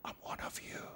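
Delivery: hushed, unvoiced confession; secretive whisper behind glass. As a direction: whisper it so only one listener hears. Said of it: "she would still whisper and say".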